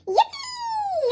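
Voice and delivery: very high excited squeal; yelled cheer. A narrator's reading. Froggy cheering about the snowman, high yell. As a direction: cheer like a delighted kid. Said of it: "yelled Froggy".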